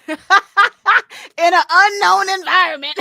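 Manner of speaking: high, loud laughter through words; laughing. Real, not acted. Laughing about fighting strangers at the party, high and loud. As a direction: laugh while saying it.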